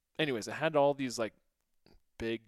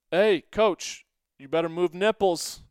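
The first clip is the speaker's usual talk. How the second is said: calling out; 5 semitones higher, 8 dB louder than usual. Retold or imitated. Calling to coach across field.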